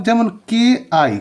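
usual speaking voice

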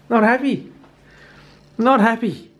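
clipped high-pitched not happy with stress on not; NOT hit hard, both times; fed up. Real, not acted. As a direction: put a firm full stop on the rant. Ending rant on nets.

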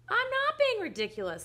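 put-on high, loud childish objection; acted outraged daughter; indignant protest. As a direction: protest hotly to mother. Daughter protests in family quarrel.

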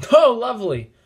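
sarcastic. Real, not acted. Sarcastic at game event.

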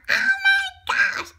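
high, slow drawn-out amazement; amazed gasp of delight. Acted. Reader marvels at all the sprinkles, high amazed squeal.

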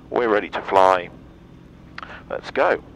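ordinary talk, nothing marked

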